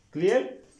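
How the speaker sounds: ordinary talk, nothing marked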